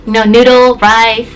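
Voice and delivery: normal talking voice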